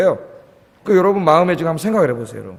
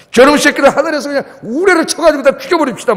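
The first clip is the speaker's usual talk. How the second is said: hot-tempered disciple; 6 semitones higher than usual. Retold or imitated. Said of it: "다혈질의 제자였어요".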